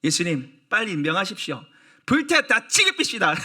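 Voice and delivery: builds to a high, loud furious demand; hammed-up angry disciple, half laughing; too strong anger. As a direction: demand fire, rising into a laughing rage. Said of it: "제가 너무 세게 얘기하죠".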